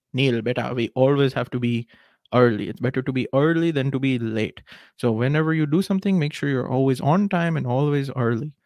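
dad's advice in a put-on Indian accent. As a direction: imitate an Indian father advising his son, Indian accent. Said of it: "bring out the Indian accent".

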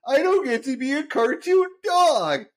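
mocking the producer with a fake sob; mock sarcastic whining. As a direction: sneer it like a spoiled kid. Homer mocks producer's rejection.

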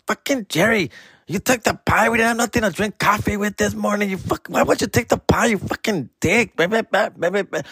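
hammed-up sibling telling him off; imitating angry sister. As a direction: sisters scolding him over the pie, mock angry. Sisters gang up scolding.

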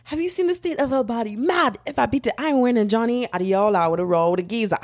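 shaky attempt at British slang patter, drifting Islander; hammed-up British with an Islander lilt; British accent. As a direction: put on a rolling British street accent. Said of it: "that probably wasn't British".

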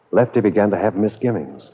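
ordinary talk, nothing marked

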